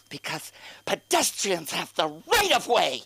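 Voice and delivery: drawn-out, self-righteous protest; slow, affronted mock pedestrian; mock indignant. Pedestrian scolding a driver, mock indignant. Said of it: "They get so indignant".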